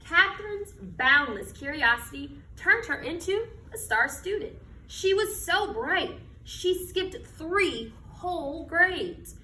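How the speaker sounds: slow, expressive picture-book reading; reading to children. Acted. Teacher reading a picture book to her class, storytelling voice. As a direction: read it like storytime on the rug.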